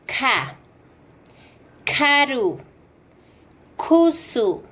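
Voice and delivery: "ka", "Karu", "Kusu" with a puff of air after the K; "ka", "Karu", "Kusu" as kha, kharu, khusu, with airy K; aspirated K. Shown as a demonstration. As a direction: breathe out hard on the K of "ka", "Karu", "Kusu". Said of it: "Continuamos con".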